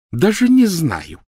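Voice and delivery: high, loud, slow sarcastic drawl; heavy sarcasm. Acted. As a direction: lean on the sarcasm. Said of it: "с жутким сарказмом".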